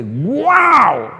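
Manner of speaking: big, high, loud wow; acted amazement. Listener awed by a flowery prayer, acted amazement. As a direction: act hugely impressed, stretching the exclamation. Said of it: "una cosa que uno dice".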